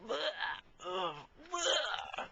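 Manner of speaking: high, strained gagging sounds; hammed-up puking noises; mock retching. Friend retching in the fridge scene.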